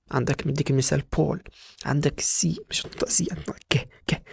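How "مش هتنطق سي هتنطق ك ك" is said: whispered correction, no voice; teacher whispering the right sound. Shown as a demonstration. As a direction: breathe it as a correction. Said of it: "whispered correction".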